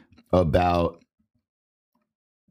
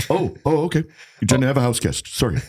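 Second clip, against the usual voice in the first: higher, flustered cop voice; movie character voice; 5 semitones higher, faster than usual. Retold or imitated. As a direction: play the awkward cop. Quoting the show character.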